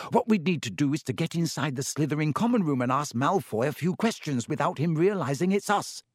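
ordinary delivery, nothing marked

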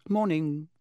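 a mildly stiff, high-pitched greeting; slightly stiff greeting. A narrator's reading. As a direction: say it with a touch of stiffness. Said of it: "a slight stiffness in the way she said".

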